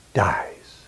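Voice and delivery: usual speaking voice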